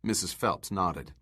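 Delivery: ordinary delivery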